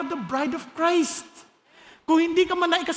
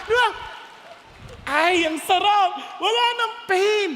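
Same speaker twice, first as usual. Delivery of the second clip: high, ringing celebration from the pulpit; exultant preaching; 8 semitones higher than usual. Real, not acted. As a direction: preach it jubilantly, pitched well up. Preaches exultantly about no more pain.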